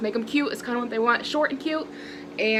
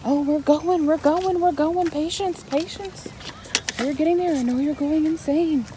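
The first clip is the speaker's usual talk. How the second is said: placating singsong; 7 semitones higher than usual. Real, not acted. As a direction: lift the voice and chant it reassuringly. Soothing an impatient child on the way.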